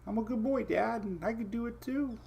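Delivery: high, soft puppy voice; voicing the dog. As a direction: speak for the dog like a proud little pup. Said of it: "He's like".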